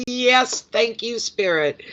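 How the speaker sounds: high, exultant cry of gratitude; real joyful relief; elated thanks. Real, not acted. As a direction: start high and triumphant, settle as you finish. Thanks Spirit for the good news.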